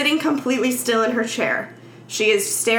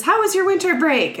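cheery teacher; 9 semitones higher than usual. Retold or imitated. Teacher greeting the class.

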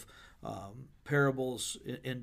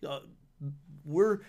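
exasperated paraphrase; 4 semitones higher than usual. Retold or imitated.

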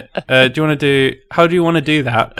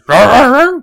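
high loud roaring troll; dinosaur voice; 13 semitones higher, 9 dB louder than usual. Acted. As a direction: roar it out like a beast. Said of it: "he, uh, goes".

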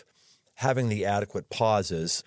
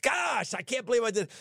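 dismayed; 12 semitones higher than usual. Retold or imitated. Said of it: "they were like".

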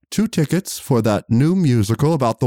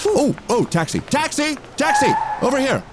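hailing a taxi. Acted. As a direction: yell for a taxi across the street. Calling for a taxi.

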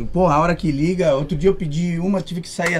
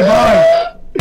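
laughing squeal; 4 dB louder than usual. Real, not acted. Laughing squeal mid-story.

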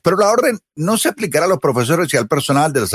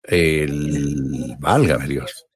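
stunned; 9 semitones lower, 4 dB quieter, much slower than usual. Real, not acted. Shocked by the lung x-ray.

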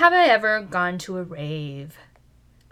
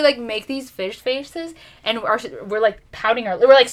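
usual speaking voice

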